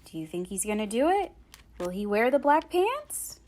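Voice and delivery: ordinary delivery, nothing marked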